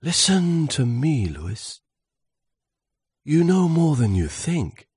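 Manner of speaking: swooshing wind. Acted. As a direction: sweep through the words like a gust. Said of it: "swooshed the wind".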